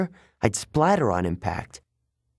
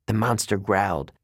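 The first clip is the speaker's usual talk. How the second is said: usual speaking voice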